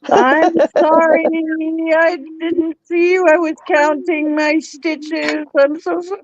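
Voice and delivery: tiny high-pitched pleading voice; mock pleading apology. Host apologising for not seeing someone waiting, mock pleading. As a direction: grovel playfully, up high.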